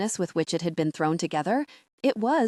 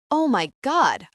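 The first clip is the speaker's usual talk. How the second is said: snapping; 6 semitones higher, 4 dB louder, slower than usual. A narrator's reading. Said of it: "I snapped at him".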